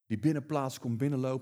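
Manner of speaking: usual speaking voice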